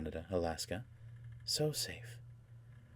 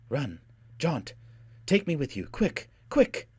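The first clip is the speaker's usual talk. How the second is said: raised, mounting cries of alarm; urgent plea; 8 semitones higher, 5 dB louder, slower than usual. A narrator's reading. Lady begging to be jaunted away, urgent and mounting. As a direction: build urgently to a shout.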